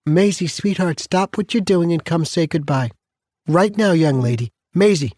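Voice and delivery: put-on grandmother calling child.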